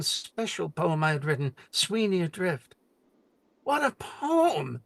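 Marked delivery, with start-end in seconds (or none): 3.6-4.8 slow, high, rapt exclamation; drawn-out delighted praise; excited exclaim. Retold or imitated. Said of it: "she kept repeating".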